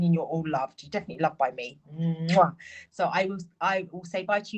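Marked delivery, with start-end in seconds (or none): 1.9-2.6 blown kiss. Real, not acted. Blowing kiss to viewers.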